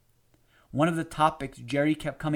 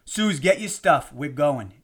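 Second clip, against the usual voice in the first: ordering; 6 dB louder than usual. A narrator's reading. Husband orders his wife to leave dinner, commanding. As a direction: order her out, brooking no argument. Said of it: "Jerry ordered".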